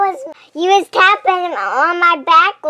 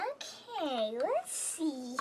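usual speaking voice

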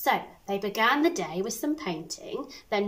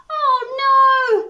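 panicked cry, much higher and louder; 14 semitones higher, 11 dB louder than usual. Acted. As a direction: bees shrieking in alarm. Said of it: "they cried".

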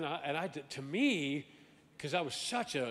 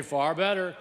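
louder prompt; 8 dB louder than usual. Shown as a demonstration. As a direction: repeat it louder, far better. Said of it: "Come on, say".

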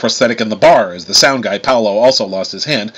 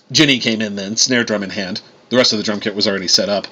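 usual speaking voice

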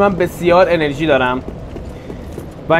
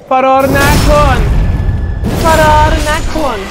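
shouting; 7 semitones higher, 4 dB louder, much slower than usual. Real, not acted. Yelling at fleeing enemy.

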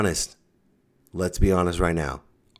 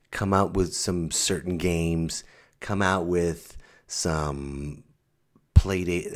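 normal talking voice

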